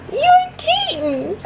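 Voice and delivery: squeaky high teasing voice; high-pitched teasing. Real, not acted. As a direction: tease the bird in a high squeal. Teasing a parrot, repeated higher.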